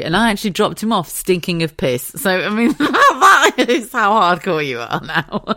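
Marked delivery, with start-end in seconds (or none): 2.8-5.4 slow, laughing delivery. Real, not acted. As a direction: laugh while saying it. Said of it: "laughing at the story".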